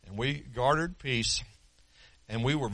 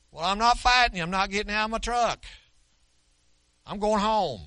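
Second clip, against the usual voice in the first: higher, louder insistent reply; firm raised refusal; 7 semitones higher, 5 dB louder than usual. Retold or imitated. Pastor in his truck refusing the angry man, raised and firm. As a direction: push back firmly but without fighting.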